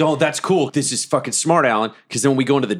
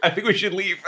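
nervous; 8 semitones higher than usual. Retold or imitated. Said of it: "And I'm like".

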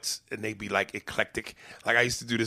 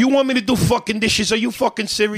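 loud, indignant New York Italian husband shouting; 9 semitones higher, 6 dB louder than usual. Pretend. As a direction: rant like a macho Italian guy asked to do dishes. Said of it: "do you do an Italian lady".

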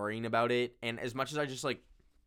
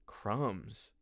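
ordinary delivery

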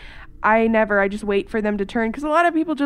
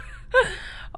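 unvoiced high giggle; breathy high laugh; 12 semitones higher than usual. Real, not acted. Host laughing at dad's joke, breathy high giggle. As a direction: crack up breathlessly.

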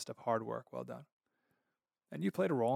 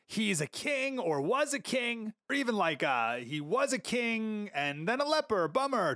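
mock epitaph; 7 semitones higher than usual.

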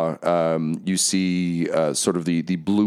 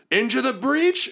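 very high rallying call; high-pitched commander's cry; Zariel's war cry. Acted. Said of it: "and she's like".

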